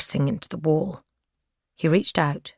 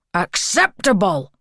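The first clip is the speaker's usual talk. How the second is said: louder burst on the last word; captor's sudden angry shout; 3 semitones higher, 5 dB louder than usual. A narrator's reading. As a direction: snap it out as a shout. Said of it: "The last words came out as a shout".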